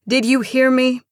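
loud, high impatient question; frustrated. A narrator's reading. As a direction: demand an answer in frustration. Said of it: "Marcia asked in frustration".

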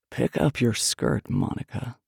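slow, low and deliberate male order; slow command. A narrator's reading. Man repeats his order to Monica, slow command. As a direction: draw the order out, dropping low.

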